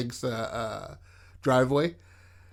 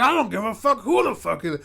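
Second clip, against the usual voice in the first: put-on angry old man; 8 semitones higher than usual. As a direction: play an irate old man in a higher voice.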